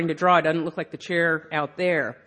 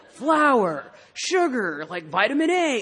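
higher-pitched wondering list; mock awe; sudden fascination; 5 semitones higher, slower than usual. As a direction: marvel at each ingredient in turn. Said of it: "We're suddenly like".

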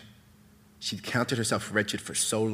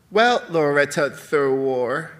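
slow, loud sing-song mockery; playful condescension; 5 semitones higher, 9 dB louder, slower than usual. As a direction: chop the first name hard, sing the last. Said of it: "chopping her first name to three hard syllables, using a sing-song child's voice for her last".

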